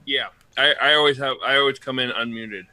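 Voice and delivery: usual speaking voice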